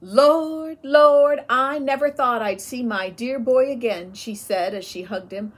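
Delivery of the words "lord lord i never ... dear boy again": loud, high cry of joy; overjoyed cry. A narrator's reading. Mother hugging her returned son, loud joyful cry. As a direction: call out to heaven in relief.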